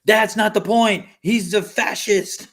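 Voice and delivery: high, loud protester's retort; mock indignation. Podcaster playing protester caught out on wealth, mock indignation. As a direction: imitate an outraged protester.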